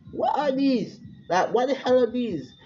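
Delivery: high, startled what are these; a girl suddenly seeing her strange gauntlets; shocked. Acted. Girl staring at her gauntlets, high and startled.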